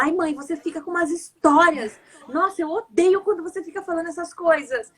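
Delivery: put-on high-pitched complaining child voice; exasperated daughter. Daughter protesting to mum about her stories, exasperated. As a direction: imitate a kid fed up with mum.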